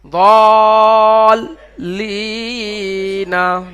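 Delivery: দলিনা stretched slowly; slow model reading. Shown as a demonstration. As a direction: sound it out for students. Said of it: "teacher models full word". (ধীরে টেনে দলিনা)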